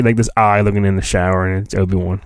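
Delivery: ordinary delivery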